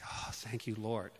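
relieved murmur. Retold or imitated. Relief after car passes.

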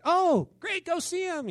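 high-pitched mock delight; exaggerated cheery change of heart; suddenly happy. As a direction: switch to gushing, sing-out approval. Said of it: "You know, the change, everybody's happy".

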